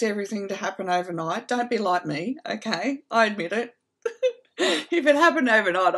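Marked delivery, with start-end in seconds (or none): 4.0-6.0 higher and louder, laughing through the words. Real, not acted. Admitting her impatience to the audience, laughing. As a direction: laugh at yourself as you say it.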